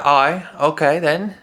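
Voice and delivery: high, slow mock British accent; British accent drifting toward Australian; put-on, exaggerated accent; slipping British accent. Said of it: "completely different accent".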